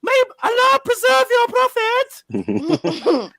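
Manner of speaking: high, slow, loud frightened plea; drawn-out terrified high-pitched cry; scared shout. Pretend. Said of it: "playing the character very well".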